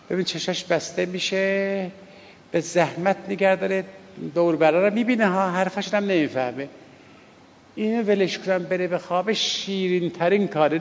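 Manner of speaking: ordinary delivery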